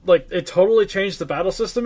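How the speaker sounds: normal talking voice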